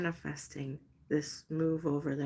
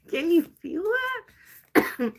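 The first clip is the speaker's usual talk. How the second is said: thrilled and laughing; 11 semitones higher, 7 dB louder, much slower than usual. Real, not acted.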